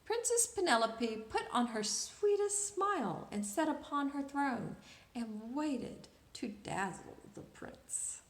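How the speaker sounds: ordinary delivery, nothing marked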